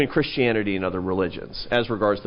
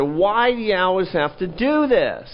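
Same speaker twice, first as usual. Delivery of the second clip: retold exasperated question, higher and slow; mock impatience, drawn out and raised; impatient; 7 semitones higher than usual. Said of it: "I got impatient one time".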